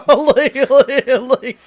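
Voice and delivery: high, loud laughing words; words squeezed out through laughter. Real, not acted. Laughing fit at own joke.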